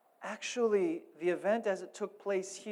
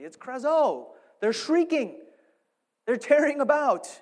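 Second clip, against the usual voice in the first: louder, higher, driving each phrase home; fired-up preaching; 7 semitones higher, 8 dB louder than usual. Real, not acted. Pastor rousing the congregation about screaming kids, loud preaching. Preacher describing shrieking children.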